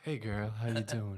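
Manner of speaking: slow, smooth pickup-line voice; chat line voice. Retold or imitated. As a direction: croon it like a late-night chat line. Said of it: "That's the chat line voice right there".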